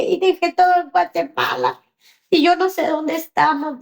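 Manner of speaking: high, plaintive lament; tearful child. Retold or imitated. Daughter who left everything in Guatemala, sorrowful. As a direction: imitate a lost, crying child. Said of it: "¿y por qué estás llorando?".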